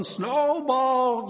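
high, drawn-out call for a lost pet. Acted. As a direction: call out for a lamb gone missing. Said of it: "He goes out looking".

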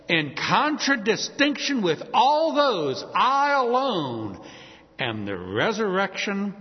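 bold, insistent proclamation, slowing on "I alone"; emphatic. Retold or imitated. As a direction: stretch out "I alone" and lean on it. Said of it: "Now, he's emphatic".

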